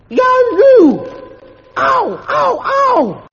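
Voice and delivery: very high, loud yelps of pain; howling yelps. A narrator's reading. Bunter howls as the cane lands, very high and loud. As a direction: howl at every stroke.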